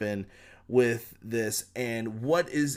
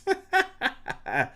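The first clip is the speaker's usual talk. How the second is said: high laugh. Real, not acted. Laughing at viewer comment.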